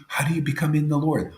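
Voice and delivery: normal talking voice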